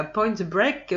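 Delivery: playful put-on English accent on the film title. Vlogger proudly listing film titles in a jokey English accent. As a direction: say Point Break the English way, a little hammed-up. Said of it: "j'ai fait mon super accent anglais".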